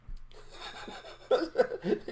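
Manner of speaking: silent high-pitched wheeze of laughter; high wheezing laugh. Real, not acted. Laughing at the girl's cucumber line, wheezing high. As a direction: let the laugh squeak out breathless.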